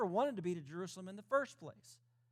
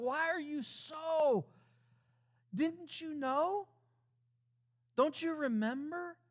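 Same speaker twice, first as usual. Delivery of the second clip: voicing Jesus; 4 semitones higher than usual. Preacher voicing Jesus questioning disciples.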